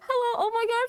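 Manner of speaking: real high-pitched, overwhelmed squeal; flustered shock. Real, not acted. Streamer greeting a sudden crowd of raiders, flustered shock. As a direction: greet them in breathless high disbelief, half laughing. Streamer startled by surprise raid.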